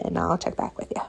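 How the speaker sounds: ordinary talk, nothing marked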